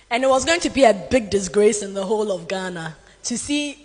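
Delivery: usual speaking voice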